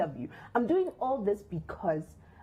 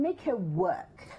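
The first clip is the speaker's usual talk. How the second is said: each word drawn out, "make her work"; slow, emphatic; much slower than usual. Real, not acted. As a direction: lean on every word, slowly.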